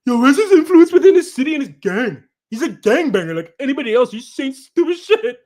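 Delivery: high, loud put-on voice; mocking chatter impression. Streamer rereads a chatter's message how he sounds, mocking. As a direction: read it as a hammed-up, high-pitched loudmouth. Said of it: "how he actually sounds".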